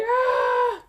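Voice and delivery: piercing high scream; distant scream. Acted. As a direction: shriek from far off. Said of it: "all you hear is like".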